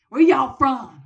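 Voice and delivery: loud, high Southern holler; hammed-up Southern call; Southern surgeon. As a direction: boom the question in a Southern accent. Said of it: "the surgeon's like".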